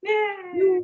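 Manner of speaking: high-pitched, delighted yeah; real little cheer. Real, not acted. Host cheering guest's citizenship plans, excited.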